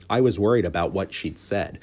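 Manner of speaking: ordinary delivery, nothing marked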